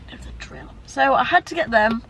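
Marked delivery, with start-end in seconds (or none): 0.1-0.7 whispered. Retold or imitated. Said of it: "And I'm like".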